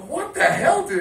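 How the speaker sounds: high-pitched incredulous protest; retold alarm. Friend finding his mate in trouble, incredulous. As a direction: act out shocked disbelief at a mate.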